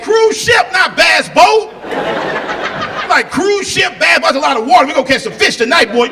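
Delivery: high loud laughing yell; high laughing shout; shouting at dad. Retold or imitated. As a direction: holler it at him over the rail. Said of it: "I'm looking at him, I said".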